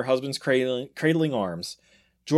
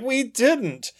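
put-on kid voice; 11 semitones higher than usual. Kids denying.

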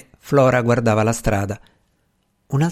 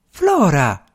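exclaimed; 5 semitones higher than usual. A narrator's reading. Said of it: "esclamò la zia Grace Steele".

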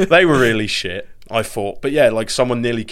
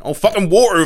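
Norfolk accent; 10 semitones higher, 5 dB louder than usual. Real, not acted. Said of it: "excuse my Norfolk accent".

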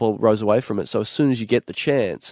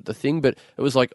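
ordinary talk, nothing marked

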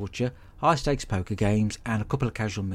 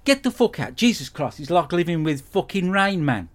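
angry rant; 6 semitones higher than usual. Acted. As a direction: rail at him like he's unbearable. Ranting at roommate.

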